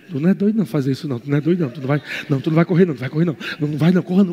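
the doubting mind hurrying you out of it; rapid soft whispery rant of inner doubt; the mind talking. Acted. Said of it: "tua mente falando".

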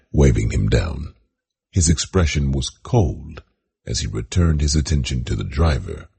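ordinary delivery

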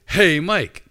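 loud, high holler across the stands; shouted call. Retold or imitated. Voice from across the stadium calling a third time, shouting. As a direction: call out hard to someone far away. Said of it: "and the voice shouted".

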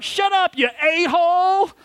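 high-pitched shout back at hecklers; retold girl's yell. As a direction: imitate an angry girl yelling out, pitch way up. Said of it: "So the girl yells out".

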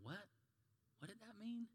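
soft, high, rising query; baffled little aside; puzzled listeners. Acted. Confused congregation after the story, puzzled and hushed. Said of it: "some of you are going".

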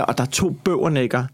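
usual speaking voice

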